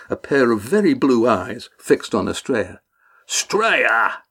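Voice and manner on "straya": name called out, drawn long; mild narrated shout across a deck. A narrator's reading. Red Ian climbing aboard calls to Astrea, shouting. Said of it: "shouted Red Ian".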